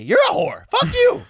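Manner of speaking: very high-pitched acted insult; mock indignation. Storyteller mimicking the quarrel with Cha-Cha, high and indignant. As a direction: squeal it as a hammed-up quarrel.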